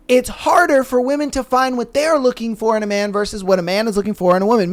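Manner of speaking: normal talking voice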